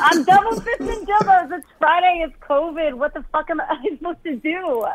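high, laughing confession. Real, not acted. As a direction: giggle it out, caught in the act. Caught holding dildos, laughing.

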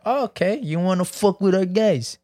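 put-on high-pitched botched French accent that comes out Italian; mock French threat slipping into an Italian accent; Italian-sounding accent. The angry French answer Mexico, in a botched Italian-sounding accent. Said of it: "that's more of an Italian accent".

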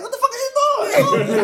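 high squealing mock outrage; laughing high-pitched what the hell; shocked outburst. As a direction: yelp it out in disbelief. Retold shock at dad.

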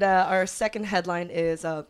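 usual speaking voice

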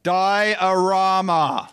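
Diorama said slowly, syllable by syllable, pitch up; over-enunciated Diorama; slow correction. Acted. Correcting misheard word slowly.

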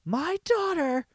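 high, drawn-out mom impression; mock a screechy stage mother; dance mom. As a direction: squeal it slowly, very high.

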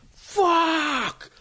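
put-on high drawn-out yell of a drunk; retold drunk yell. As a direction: bellow it like a drunk in the street. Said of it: "Like, top of his lungs".

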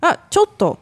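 high, quick "Ah, chotto" to get attention; calling out chotto. Shown as a demonstration. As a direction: call "Ah, chotto" sharply up high to catch someone. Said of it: "So you could just say".

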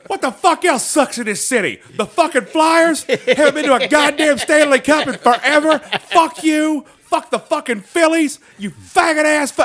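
high, loud imitated comedy tirade; imitated furious rant. Comic rants at the Philly crowd, high loud imitated fury. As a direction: rant at the city like a comic gone off. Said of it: "His rant at the city of Philly".

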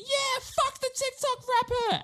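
high jeering crowd chant. Retold or imitated. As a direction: jeer like a rowdy crowd. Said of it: "everyone's like".

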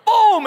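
loud, high, drawn-out boom; explosive imitated impact noise. Imitating the shoe hitting the spider, loud boom.

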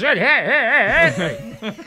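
slow high laughing heys; laughing mock call. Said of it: "What about this?".

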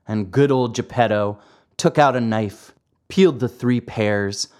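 ordinary delivery, nothing marked

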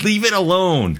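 high-pitched exasperated plea; mock protest. Host pleading with remake makers, high mock protest. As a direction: wail it in mock outrage.

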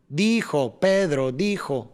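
slow beat on each first syllable of "Dijo, Pedro dijo"; rhythmic stress. Shown as a demonstration. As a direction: measure out "Pedro dijo" like musical beats. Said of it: "ahí empieza ahí la rítmica". ("Pedro dijo" lento y acompasado, marcando cada tónica)

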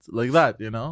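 usual speaking voice

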